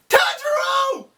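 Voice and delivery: high, loud call of a name. Retold or imitated. A swordsman calls to a falling comrade. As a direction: shout his name across the fall.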